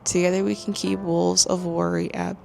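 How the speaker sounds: hushed voice, soft so nobody wakes; whispering. Real, not acted. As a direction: keep it down, someone's asleep next door. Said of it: "I am gonna whisper because Jason's literally sleeping".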